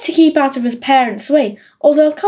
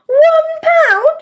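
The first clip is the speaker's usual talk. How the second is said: very high, stunned question; astonished. A narrator's reading. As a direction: gasp it out in disbelief. Peter stunned by the offer.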